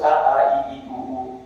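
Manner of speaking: "a, a, i, i, u, u" as a low, even vowel chain; reciting Sanskrit letters. Shown as a demonstration. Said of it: "the letters of the syllables".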